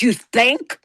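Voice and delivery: retold snappy comeback; sarcastic retort. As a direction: throw it back sarcastically at the obvious. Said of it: "like that".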